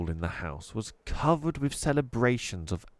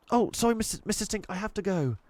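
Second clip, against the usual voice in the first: fast, high flustered apology; panicky hurry; 12 semitones higher, faster than usual. Acted. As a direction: rush out the excuse nervously. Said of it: "she was beginning to panic".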